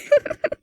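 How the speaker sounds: a high little giggle. Real, not acted. Reader returning to the book with a giggle. Reader laughing after aside.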